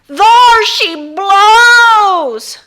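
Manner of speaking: high, loud, very slow drawn-out call; a kid at the back bellowing at the spray; yell. Acted. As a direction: bellow it like a whaler. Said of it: "someone yelled".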